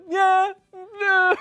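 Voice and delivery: high, loud wavering verdict; noncommittal yeah. Shown as a demonstration. Co-host scoring the acting on the scale, high, hedging yeah. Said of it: "I'm giving it, like".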